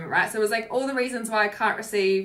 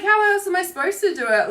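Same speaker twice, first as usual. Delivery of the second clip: high, loud frustrated question; a frustrated, pitched-up demand; exasperated plea; 8 semitones higher, 6 dB louder than usual. Retold or imitated. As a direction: push back at the voice, exasperated. Pleading with inner nasty voice.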